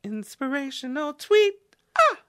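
very high, cutesy cartoon squeak; Betty Boop voice. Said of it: "get it, Betty Boop".

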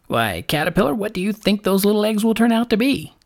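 lark voice climbing high; lightly voiced quizzing bird; challenging lark.

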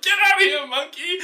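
high, mock shout at the monkey; mock shooing dad. Host voicing the dad chasing the ape with a bat. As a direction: chase it off, half-hearted. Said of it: "he's like".

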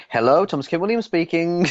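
high, polite phone greeting; formal phone voice. Retold or imitated. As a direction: answer like a stranger's call. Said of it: "you're like".

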